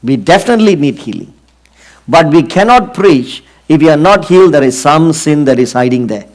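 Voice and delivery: normal talking voice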